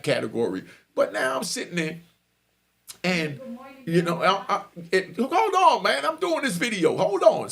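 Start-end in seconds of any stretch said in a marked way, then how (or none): 5.2-7.5 raised, high, impatient call. Real, not acted. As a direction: shout to someone off-mic to wait. Calls to someone interrupting his recording.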